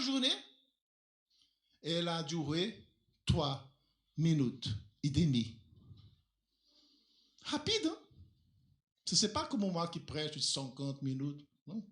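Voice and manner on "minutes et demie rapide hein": normal talking voice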